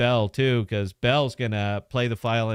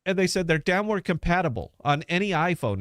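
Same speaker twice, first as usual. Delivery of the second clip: normal talking voice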